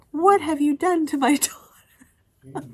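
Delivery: high, loud, shocked mother laughing through it; mom's shocked voice. Retold or imitated. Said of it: "She was like".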